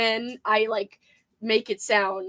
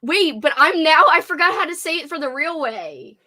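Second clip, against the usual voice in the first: ordinary talk, nothing marked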